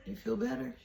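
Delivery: softly asked, lifted in pitch; gentle spirit voice. Retold or imitated. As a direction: check in gently, high and soft. Spirit asks gently in retelling.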